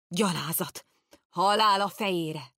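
a distant lord bellowing in anger. A narrator's reading. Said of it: "végigbömbölte az egész folyosót". (bömbölő kiáltás)

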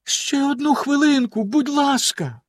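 high-pitched entreaty; timid, very high plea; pleading. A narrator's reading. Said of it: "попросила його дружина".

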